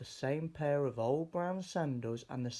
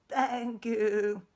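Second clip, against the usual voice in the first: high, louder goat thanks; grateful goat; 10 semitones higher, 5 dB louder than usual. Acted. Goat thanking George for the sail, high and grateful. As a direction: thank him in the goat's high voice.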